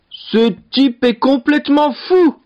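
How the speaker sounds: high, emphatic lordly exclamation; storybook lord's high-pitched outburst; outraged cry. A narrator's reading. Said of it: "s'écria le seigneur".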